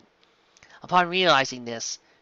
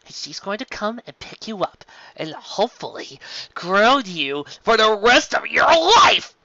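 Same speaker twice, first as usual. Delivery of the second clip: rising scold; 7 semitones higher, 5 dB louder than usual. Acted. Scolding a caught filly.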